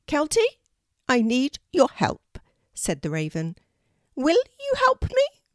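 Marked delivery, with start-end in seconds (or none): 0.1-2.4 high, loud bird voice; an urgent talking raven; unusual raven voice. A narrator's reading. Raven Harris asks help. 4.1-5.4 high, loud bird voice; an urgent talking raven; unusual raven voice. A narrator's reading. Raven Harris asks.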